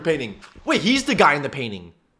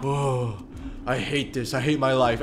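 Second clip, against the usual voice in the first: low, soft mock misery; a hint of a glum, suffering golem; lightly voiced miserable golem; 4 semitones lower, 5 dB quieter than usual. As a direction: grumble it quietly as the put-upon golem. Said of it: "the golem is just like".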